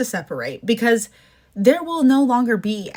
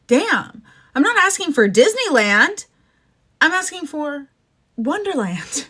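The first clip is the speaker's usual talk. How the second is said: pitched up and louder, fed up; exasperated outburst; 7 semitones higher, 3 dB louder than usual. Real, not acted. Mother ranting to viewers about park prices, exasperated and pitched up. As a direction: throw your hands up, it's not much to ask.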